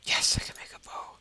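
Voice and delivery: loud, forceful stage whisper; excited whisper. Real, not acted. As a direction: whisper it hard, thrilled at the find. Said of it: "I'm whispering again".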